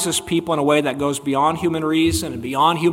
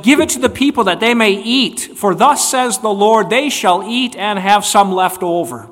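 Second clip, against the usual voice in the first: commanding prophet; 5 semitones higher, 8 dB louder than usual. Retold or imitated. Elisha commands with authority.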